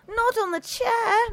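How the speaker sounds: high, drawn-out cry of warning. A narrator's reading. Housekeeper warning as the match is struck, crying out. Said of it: "Missus Stoner cried".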